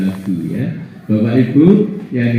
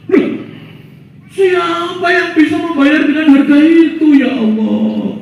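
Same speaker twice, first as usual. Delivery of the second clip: higher, marveling voice of the man; awed questioner; 3 dB louder than usual. Acted. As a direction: ask God in wonder. Man asks God. (suara takjub bertanya kepada Allah)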